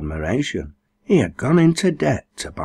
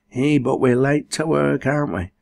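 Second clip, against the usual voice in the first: higher, apologetic; mother excusing herself to no one, apologetic; 7 semitones higher than usual. A narrator's reading. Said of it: "said Rob's mother apologetically".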